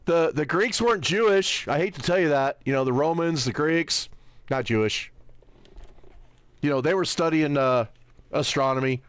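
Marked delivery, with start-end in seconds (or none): none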